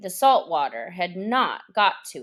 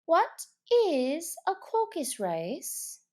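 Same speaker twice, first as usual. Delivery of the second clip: put-on a higher girlish question; curious young girl, a touch higher; lightly voiced Alice asking; 9 semitones higher, 4 dB quieter than usual. A narrator's reading.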